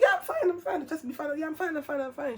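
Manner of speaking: high, hurried insistence of being fine; feigned calm. Retold or imitated. Pregnant girl hiding worry from best friend, feigned calm. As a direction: brush off concern in a high, lighter voice. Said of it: "tried to look calm".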